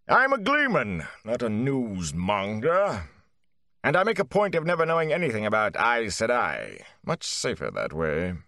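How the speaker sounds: disdain the news trade; haughty Gleeman. A narrator's reading. Gleeman refuses Mat's questions, haughty.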